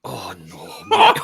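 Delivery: usual speaking voice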